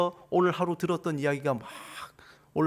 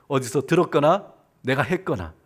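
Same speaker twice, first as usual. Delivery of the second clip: ordinary talk, nothing marked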